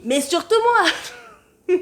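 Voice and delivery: cheeky self-centred punchline; emphatic. Acted. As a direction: proudly claim it all for yourself. Punchline claiming it's for herself.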